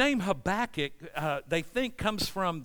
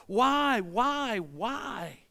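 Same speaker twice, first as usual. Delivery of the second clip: anguished why; 3 semitones higher, much slower than usual. Acted. Preacher voicing a questioning believer.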